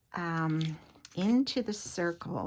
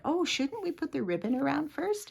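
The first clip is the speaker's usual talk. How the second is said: lightly voiced friend, higher pitched; a playful higher-pitched take on her friend's question; imitating friend Sandy; 9 semitones higher, 4 dB louder than usual. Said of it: "my friend Sandy said".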